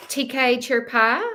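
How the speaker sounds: a touch of American twang, drawn out. Shown as a demonstration. Greeting viewer T K with a light American twang. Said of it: "put on a little American twang at the end".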